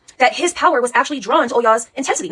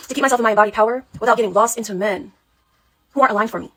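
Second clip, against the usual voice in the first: ordinary talk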